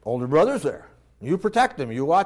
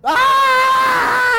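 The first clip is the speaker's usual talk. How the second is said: put-on shrieking high child's tantrum scream; 8 dB louder than usual. A spoiled child in the grocery store, tantrum scream.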